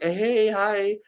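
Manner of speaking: high, perky fake Canadian hello; fake Canadian accent. Liar saying hello to the Canadians, fake Canadian accent. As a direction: greet in a put-on upbeat Canadian voice. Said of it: "I put on a little fake Canadian accent".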